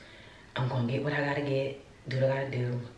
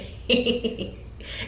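laugh; 11 semitones higher than usual. Real, not acted. Laughs after retold line.

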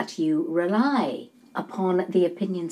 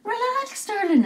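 high, lilting soothing; breezy reassurance; 13 semitones higher than usual. Acted. Palm reader reassuring a reluctant client, breezily high. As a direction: sing it out high to put her at ease.

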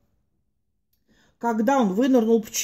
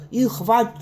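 ordinary delivery

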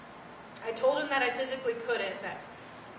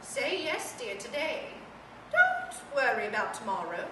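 soft, lightly voiced old lady; gently coax, a hint of a dowager; frail withering duchess; 3 semitones lower, 6 dB quieter than usual. Toy softly advises, dear.

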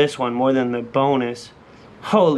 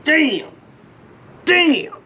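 high, elated victory yell; real excited shouting, building to long whoops; yelling at the camera; 12 semitones higher, 3 dB louder, much slower than usual. Real, not acted. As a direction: holler it at the camera in triumph. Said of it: "I'm yelling at the camera".

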